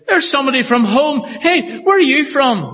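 thrilled greeting a fellow Northern Irish voice; delighted recognition. Retold or imitated. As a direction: call out to someone from home. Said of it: "and you say".

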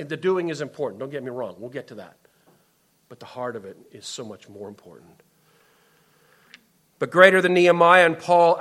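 ordinary talk

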